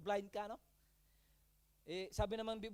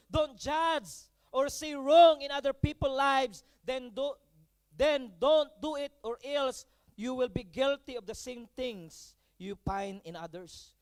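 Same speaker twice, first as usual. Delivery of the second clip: slow, weighty scripture quote; preacher reciting a verse with weight; scripture reading; 3 semitones higher, 8 dB louder, slower than usual. Acted. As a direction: lay each word of the verse down slowly. Pastor quotes scripture slowly.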